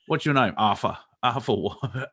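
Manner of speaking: common British back-and-forth; mock working-class British dialogue; average British accent. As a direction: play two everyday Brits introducing themselves. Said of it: "your average British".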